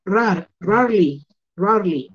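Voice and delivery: rarely said as rarli, no middle e; teacher showing the silent e; rarely without the e. Shown as a demonstration. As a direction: drop the e in "rarely": rar-li. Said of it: "yo no voy a pronunciar la E".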